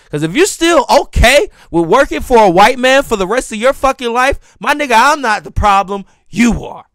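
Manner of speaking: loud, heated callout; furious rant. Real, not acted. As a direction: blast the commenter, loud and angry. Furious reply to the commenter.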